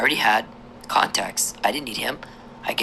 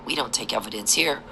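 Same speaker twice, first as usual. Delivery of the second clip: ordinary talk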